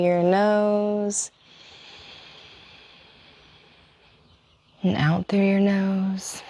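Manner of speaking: slow, calm instruction with long pauses; guided yoga breathing. Acted. Yoga teacher leading the class into breathing, slow and calm. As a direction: stretch out the last words to pace each breath.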